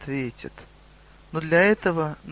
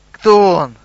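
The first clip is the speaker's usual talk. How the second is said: very loud, higher-pitched demand; shouted question; 4 semitones higher, 13 dB louder than usual. A narrator's reading. Goblin interrupting the centaur's story, shouted demand.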